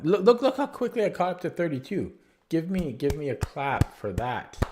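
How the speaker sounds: excited brag asking for applause; excited boasting. Real, not acted. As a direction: show off your speed, thrilled. Boasting about quick progress.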